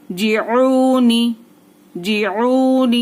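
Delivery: "जिऊनी" with the "ऊ" held long; long vowel. Shown as a demonstration. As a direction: recite "जिऊनी" very slowly, stretching the middle vowel. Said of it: "वाव साकिन से पहले पेश". ("जिऊनी" में ऊ को खींचकर)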